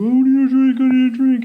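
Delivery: put-on high, loud, pleading voice of a drunk partygoer; mock demanding customer. Bartender imitating packed party guests begging for drinks, high and loud. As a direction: whine it like someone begging the bartender.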